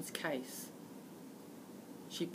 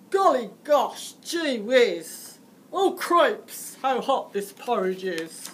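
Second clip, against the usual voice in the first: loud startled outburst, higher on the exclamations; Dad yelps at the scalding porridge; crying out; 4 semitones higher, 10 dB louder than usual. Acted. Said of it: "Then Dad cries".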